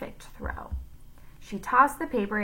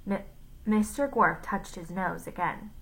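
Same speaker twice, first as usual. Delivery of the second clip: ordinary delivery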